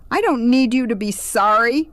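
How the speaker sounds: high, loud, angry outburst; panicked protest flaring up; shriek. A narrator's reading. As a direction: shriek it back at her. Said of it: "she shrieked".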